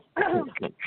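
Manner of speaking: imitated awkward throat clear; mom's throat clearing. Daughter imitating mom during an awkward scene, throat clearing. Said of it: "my mom goes".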